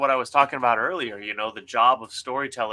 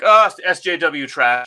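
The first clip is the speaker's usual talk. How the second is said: loud mock dismissal, pitched up; imitating a knee-jerk critic, raised voice; mocking dismissive reader; 5 semitones higher, 9 dB louder than usual. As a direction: scoff it out like a scornful reader.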